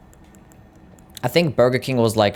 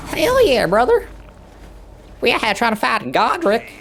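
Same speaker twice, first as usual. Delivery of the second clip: hammed-up American Southern accent, much higher; 11 semitones higher than usual. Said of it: "Speak in an American Southern accent".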